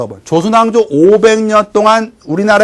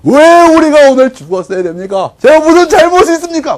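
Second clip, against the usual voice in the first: high, loud rhetorical questions; rousing oration; 9 semitones higher, 5 dB louder than usual. Acted. Rescued brothers addressing villagers, rousing oration. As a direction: harangue a crowd with indignation. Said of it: "일장연설을 하게 돼요".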